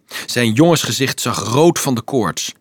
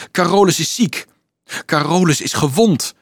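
higher-pitched rumour going round; passed mouth to mouth; 6 semitones higher than usual. A narrator's reading. Children passing on news of Carolus, alarmed report. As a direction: spread the worried news. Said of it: "ging het van mond tot mond".

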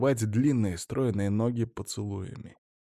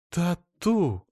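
exclaiming; 8 semitones higher than usual. A narrator's reading. Wife amazed at her husband, exclaiming. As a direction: call his pet name in astonishment. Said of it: "вскрикнула Эльза".

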